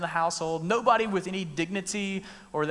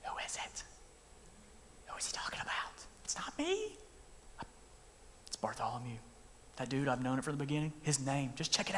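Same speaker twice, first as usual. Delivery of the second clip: hushed gossiping whisper, breathless and secretive; 4 semitones lower, 12 dB quieter than usual. Retold or imitated. Disciples gossiping among themselves in a whisper. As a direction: whisper it like nervous kids passing rumours. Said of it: "They start whispering".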